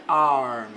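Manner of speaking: "arm" with a wide-open mouth, long a; "arm" open-mouthed, long a sound. Shown as a demonstration. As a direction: say "arm" with the jaw dropped open. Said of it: "A boca ela fica mais aberta".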